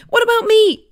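calling out. Acted. Voice calls out from leaves.

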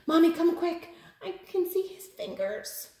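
high frightened call for mommy; scared call. A narrator's reading. Little monster calls mommy, scared. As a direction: squeal for mommy in a panic.

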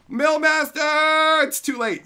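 loud, drawn-out call. Real, not acted. Streamer calling a chatter by name, loud drawn call.

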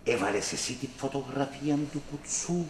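quiet menace. Acted. Slow quiet menacing question.

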